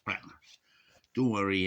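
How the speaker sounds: ordinary delivery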